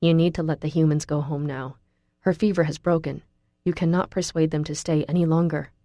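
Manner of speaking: usual speaking voice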